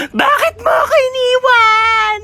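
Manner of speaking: high, slow hammed-up sob; mock wailing. Mourner wails over the coffin, hammed-up sobbing. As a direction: wail it like a fake mourner.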